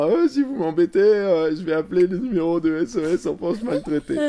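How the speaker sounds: put-on high, loud cheeky child voice; bratty kid threat. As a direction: taunt your parents like a smug kid. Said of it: "en disant".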